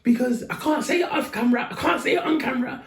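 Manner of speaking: high, hammed-up accusation; retold high-pitched outburst. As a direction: imitate a worked-up man in a high voice. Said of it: "he goes".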